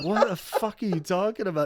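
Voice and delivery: a hint of Robert's confusion; baffled Robert. Robert reacts to the makeup lady's story, lightly voiced and baffled. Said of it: "He's like".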